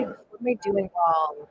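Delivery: ordinary talk, nothing marked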